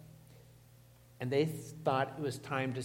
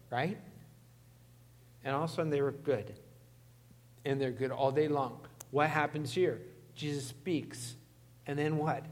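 ordinary talk, nothing marked

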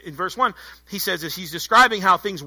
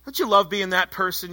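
ordinary talk

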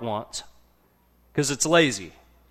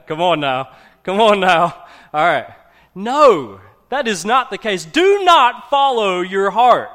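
a preacher's loud rebuke of the advice; preaching at full force; 6 semitones higher, 13 dB louder than usual. Real, not acted. Preacher rebuts worldly advice.

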